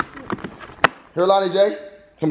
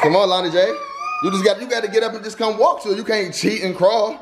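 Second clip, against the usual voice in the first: high coaxing; 8 semitones higher than usual. Real, not acted. Urging baby to walk.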